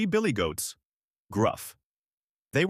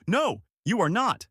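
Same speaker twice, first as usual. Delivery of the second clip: higher defiant shout; 9 semitones higher than usual. Acted. Biggest goat defies the troll, higher defiant shout. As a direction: fling the refusal back, pitched high. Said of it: "lowered his horns and shouted".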